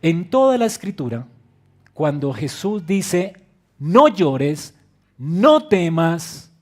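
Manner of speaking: preacher booming Jesus' promise to grieving listeners; full-force preaching. Acted. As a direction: declare "No llores" as a promise.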